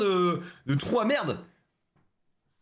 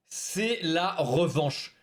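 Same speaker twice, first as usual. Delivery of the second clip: ton de bande-annonce, très lent; détacher chaque mot gravement; lent et solennel; much slower than usual. Acted. (voix de bande-annonce)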